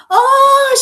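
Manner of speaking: loud, high yelp; pained cry. A narrator's reading. Little bird scalded by hot payasam, crying out. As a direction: cry out sharply as if burnt. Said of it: "she cried".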